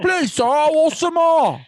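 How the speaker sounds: high, loud, slow mock-orphan plea; hammed-up British orphan begging; Oliver Twist. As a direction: put on a pleading little-orphan voice. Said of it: "I go see fucking Oliver".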